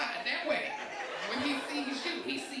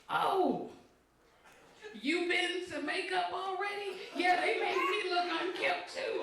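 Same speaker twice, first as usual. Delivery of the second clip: imitating young actor; 7 semitones higher, slower than usual. Young co-star voiced, innocent.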